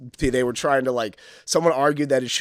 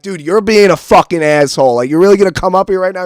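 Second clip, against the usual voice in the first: confrontation; 6 semitones higher, 11 dB louder than usual. Retold or imitated. Face-to-face fight with heckler.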